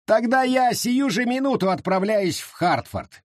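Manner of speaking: high, brisk announcement; smug official. A narrator's reading. Wallis to the reverend, high and triumphant. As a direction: declare it triumphantly.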